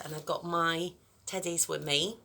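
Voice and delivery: usual speaking voice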